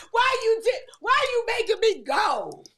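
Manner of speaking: high, loud, slow mock wail; imitating her teenage self shouting at her mother; retold tantrum. Kid at camp drop-off yelling at mother, loud and high. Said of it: "I was mad".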